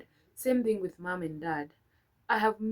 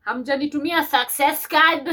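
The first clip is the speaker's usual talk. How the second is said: mock sulky complaint; 5 semitones higher, 9 dB louder than usual. Playful reading of childish complaint.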